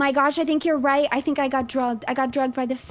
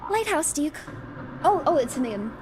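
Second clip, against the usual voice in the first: ordinary talk, nothing marked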